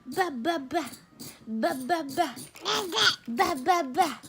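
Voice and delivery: put-on high, sing-song talk to a baby; mother coaxing her baby to say bye-bye, sing-song. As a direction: coo at the little one, high and sweet.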